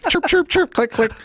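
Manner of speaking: high chirps and clicks mimicking a tic; imitated tic noises.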